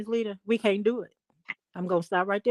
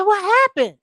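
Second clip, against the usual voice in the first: startled question; 12 semitones higher, 9 dB louder than usual. Retold or imitated. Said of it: "I said".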